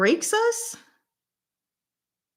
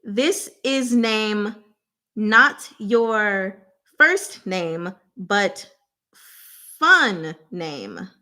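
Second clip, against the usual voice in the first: slow clue; much slower than usual. Real, not acted. Slowly stressing clue words.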